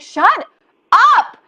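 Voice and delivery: sudden high, loud shout at the inner critic; yelling shut up. Acted. To her guilt-voice about food, a high loud shout. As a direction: snap back hard at the nagging voice.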